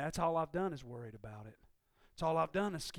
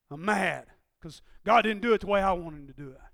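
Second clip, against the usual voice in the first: ordinary talk, nothing marked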